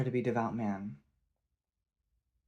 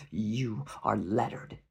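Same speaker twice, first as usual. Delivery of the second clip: slow, scornful sneer; slower than usual. A narrator's reading. Chieftain sneers at the lettered prisoner. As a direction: draw it out with contempt for the captive. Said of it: "your Skotha sneered".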